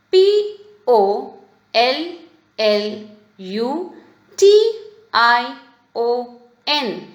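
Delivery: slow, separated letters; measured classroom dictation of letters; spelling out. Shown as a demonstration. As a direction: spell it for young pupils, letter by letter. Teacher spells the underlined word.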